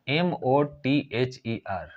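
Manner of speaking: slow, letter-by-letter spelling of एम ओ टी एच ई आर; dictating letters one at a time; spelled out letter by letter. Shown as a demonstration. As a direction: spell each letter out slowly for the class. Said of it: "यह है mother".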